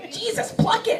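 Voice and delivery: high, appalled mock outburst; horrified guy. Guy she knows, horrified, a high mock outburst. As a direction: shoot up high in disbelief. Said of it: "He's like".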